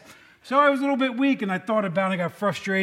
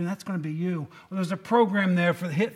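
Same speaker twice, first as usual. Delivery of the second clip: normal talking voice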